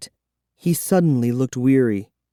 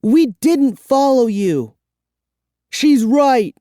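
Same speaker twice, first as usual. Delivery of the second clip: put-on higher, louder kid's protest; young voice, indignant; 12 semitones higher, 4 dB louder, slower than usual. A narrator's reading. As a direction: insist like an upset child. Kids arguing with vampire.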